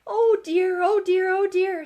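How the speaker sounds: high, loud worried squeak; a frightened little Piglet voice; fretful Piglet. A narrator's reading. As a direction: fret aloud like a small scared pig. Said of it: "said Piglet to himself".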